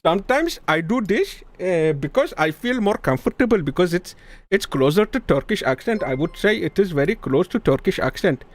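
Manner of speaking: put-on Indian-accented English; lilting Indian English accent. Shown as a demonstration. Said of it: "Bazen Hintli oluyor".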